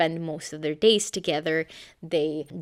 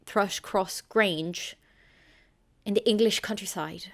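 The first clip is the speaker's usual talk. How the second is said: British accent; 2 semitones higher than usual. Shown as a demonstration. Reviewer showing how the place name hits best, British accent. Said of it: "say it with a British accent".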